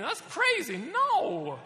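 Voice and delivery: mock outraged dad. Mock outrage at baby holders.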